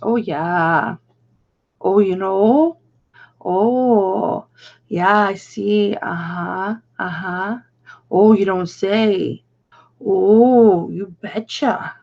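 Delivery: put-on a light Upper Peninsula Yooper accent, drawn out and slow. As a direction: play a folksy Yooper nodding along, lightly done. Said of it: "they, they talk like".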